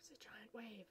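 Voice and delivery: soft whispered dread; soft fearful whisper; hushed alarm. Acted. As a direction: whisper it in awe.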